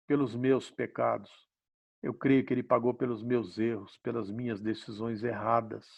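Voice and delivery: a hint of an earnest prayer to the Father. Man reliving his prayer to God, gentle prayer.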